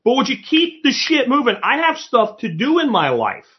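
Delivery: high, fed-up mock rant; exasperated. A shopper stuck in line, pitched high in exasperation. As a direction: let your impatience spill over. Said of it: "I'm like".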